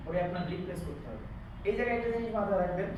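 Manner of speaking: normal talking voice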